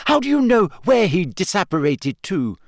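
high-pitched argumentative challenge; sharp retort. A narrator's reading. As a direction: throw the question back at him. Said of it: "Hermione shot back".

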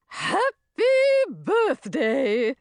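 high, drawn-out fairy greeting; high singsong creature voice; swamp fairy. A narrator's reading. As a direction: put on a fairy voice for a birthday wish. Swamp fairy greets birthday girl.

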